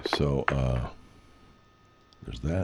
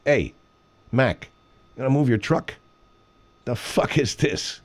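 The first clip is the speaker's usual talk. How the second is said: higher Chicago street guy asking about the truck; Chicago accent; 5 semitones higher than usual. Shown as a demonstration. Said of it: "but Chicago is more like this".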